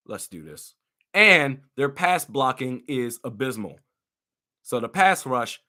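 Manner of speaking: usual speaking voice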